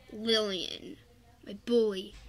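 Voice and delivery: ordinary talk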